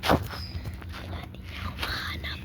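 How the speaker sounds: hushed hiding whisper; whispering while hiding. Real, not acted. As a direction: whisper so you're not found. Hiding from seeker.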